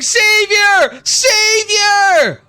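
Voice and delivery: high, loud adoring cry; devoted follower crying out to the president; worshipful cry. Acted. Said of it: "One person saying".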